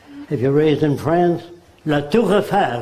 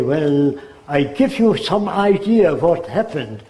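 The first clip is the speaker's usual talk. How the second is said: imitating a German speaking English; lightly put-on German-English accent; a hint of a German immigrant. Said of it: "That's the way they speak".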